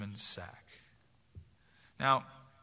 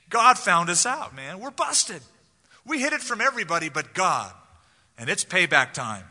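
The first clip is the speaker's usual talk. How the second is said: higher and louder, caught red-handed; busted brothers; 3 semitones higher, 6 dB louder than usual. Retold or imitated. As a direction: blurt it out like guys caught in the act. Brothers admit guilt, acted.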